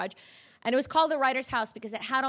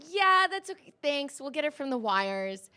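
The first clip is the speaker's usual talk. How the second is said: polite brush-off; 6 semitones higher, 4 dB louder than usual. Retold or imitated. Editor politely declines.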